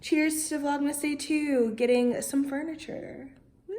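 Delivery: high, bright celebratory cheers ending in a whoop; cheery toast. Real, not acted. Vlogger toasting her viewers over new furniture, excited cheer. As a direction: raise a glass and cheer it.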